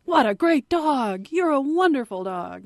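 lavish praise on a dog that got it right; high-pitched, happy praise-the-puppy voice; gushing dog praise. Acted. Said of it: "reward the dog with praise".

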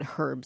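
herb said the New Zealander's way, H sounded. Retold or imitated. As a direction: say herb with an audible H. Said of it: "master gardener from New Zealand".

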